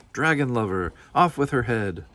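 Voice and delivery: hammed-up mob yell; shouted. A narrator's reading. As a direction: call for her head like an angry crowd. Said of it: "they shouted".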